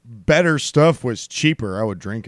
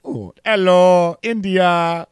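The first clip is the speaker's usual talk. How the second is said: put-on Indian accent; 4 semitones higher, slower than usual. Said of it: "it's the same impression".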